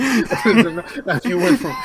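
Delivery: talking through laughter; laughing. Real, not acted. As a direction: tease while cracking up.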